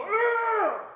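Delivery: high, loud cathartic "Arr!"; a full loud cry to release feeling; demo release yell. Shown as a demonstration. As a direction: let it out as a loud, high roar. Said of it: "Just go".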